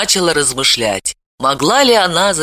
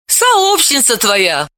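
smirking; 8 semitones higher, much slower than usual. A narrator's reading. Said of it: "усмехнулась Далила".

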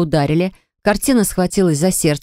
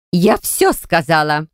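ordinary delivery

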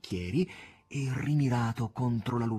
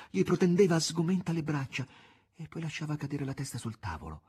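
ordinary delivery, nothing marked

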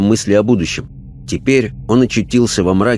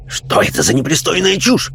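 much higher, scandalised religious zealot; high, fussy fanatic's protest; indignant fanatic; 8 semitones higher than usual. A narrator's reading. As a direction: bristle with outrage at the nonsense. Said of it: "шумный и вспыльчивый персонаж".

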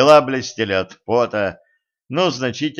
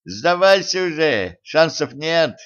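taunting yell, pitched up; shouting across the ring; 6 semitones higher than usual. A narrator's reading. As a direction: call out to give up. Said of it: "Орал секундант Тима".

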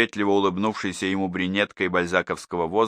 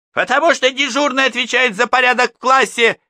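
much higher, louder heated retort; raised voices; 7 dB louder than usual. A narrator's reading. Teacher argues with mothers in raised voices. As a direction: hammer the rule home. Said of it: "на повышенных тонах".

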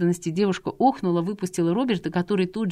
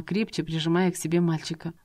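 usual speaking voice

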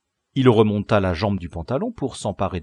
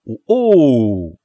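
pleased surprise; 9 dB louder than usual. Acted. Leader delighted by his find.

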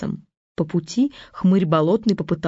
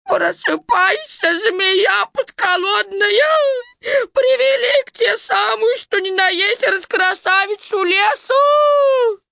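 high, slow wailing through tears; sobbing shout at the dragon; crying yell; slower than usual. Acted. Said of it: "орёт сквозь слёзы".

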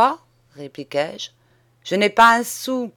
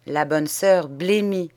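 plain reading voice

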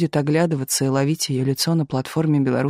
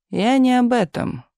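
slow, higher-pitched dismissal; mildly irritated Stalin; 8 semitones higher than usual. A narrator's reading. Stalin cuts off the writer's thanks, mildly irritated. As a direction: brush it aside slowly, a touch annoyed. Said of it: "поморщился Сталин".

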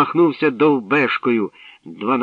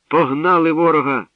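high, loud triumphant cry; exultant, raised voice; excited shout; 6 semitones higher, 6 dB louder than usual. A narrator's reading. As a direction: shout the good news out. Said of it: "закричала Мирося".

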